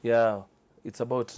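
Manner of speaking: ordinary delivery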